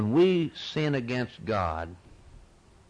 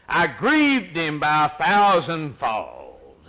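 slow, earnest reciting of a hymn; hymn recitation; 3 semitones higher, 4 dB louder, slower than usual. Acted. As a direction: read the verse like a plea for mercy.

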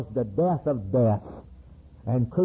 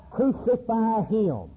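crowd's cry; 7 semitones higher, slower than usual. Retold or imitated. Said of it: "And they cried".

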